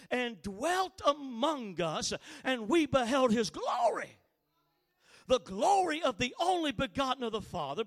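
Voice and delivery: emphatic preacher declaiming scripture; ringing, weighty Bible reading; scripture proclamation. Acted. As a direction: proclaim the verse with swelling force. Proclaims the verse to congregation.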